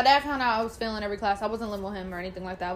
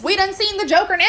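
excited retelling; 9 semitones higher, 10 dB louder than usual. Retold or imitated. Laughing retelling confronting mom.

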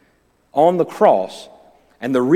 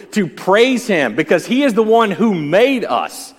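higher, louder, slowed preach; full-force preaching; 6 semitones higher, 6 dB louder than usual. Real, not acted. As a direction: preach it with fervor.

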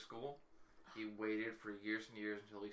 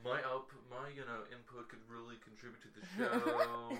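mock pitch voice; 4 semitones higher, 7 dB louder than usual. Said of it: "I was like".

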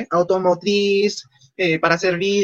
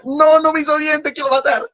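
mock exasperation; 9 semitones higher than usual. Joking exasperation at the mentor.

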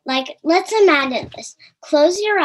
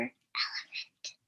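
breathy whispered word, barely audible; 15 dB quieter than usual. Shown as a demonstration. Showing children how softly God could speak, whispered. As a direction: whisper it like a tiny secret. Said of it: "he could just whisper".

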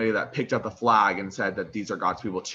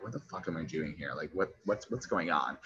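hushed, puzzled murmur; confused in dream; 5 semitones lower, 9 dB quieter than usual. Retold or imitated. Alone outside the hospital, a hushed bewildered murmur. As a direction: mutter it, lost and bewildered. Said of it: "I'm like".

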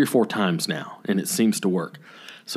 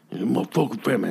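quick mumbled imitation; fast, indistinct mock griping; mumbling angry man; 4 dB quieter, faster than usual. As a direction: lightly mimic a man grumbling. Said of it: "He just mumbled something".

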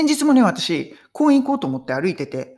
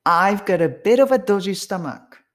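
quicker take with the words joined; sped up, linked; 2 semitones higher, slower than usual. Shown as a demonstration. Second repeat-after-me take for learners, faster and linked. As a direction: run "bit of a" together as one word. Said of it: "三回目をスピードアップして".

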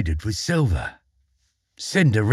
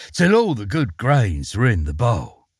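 usual speaking voice

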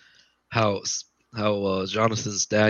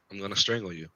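softer, straight-faced threat; deadpan threat; 7 dB quieter, faster than usual. Retold or imitated. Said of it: "I was straight face".

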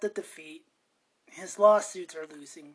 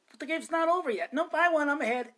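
put-on petulant kid back-and-forth; 7 semitones higher, faster than usual.

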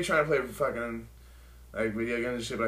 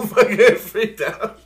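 laughing; 7 semitones higher, 7 dB louder, much slower than usual. Real, not acted. Laughing at himself.

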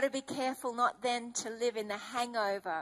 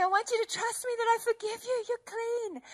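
tender reassurance; 9 semitones higher than usual. Retold or imitated. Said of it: "He's saying".

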